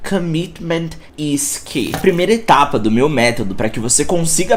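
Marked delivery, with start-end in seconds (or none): 0.1-1.9 slow English line. Shown as a demonstration.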